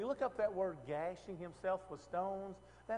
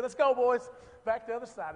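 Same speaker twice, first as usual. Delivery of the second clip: loud, higher mock rallying shout; calling out; 5 semitones higher, 10 dB louder than usual. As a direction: holler to the crew in playful urgency. Acting out calling the boys away.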